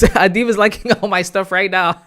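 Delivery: can't hold back a giggle while reading it out; real delighted laughter; laughing. Real, not acted. Laughing as a fan likes posts.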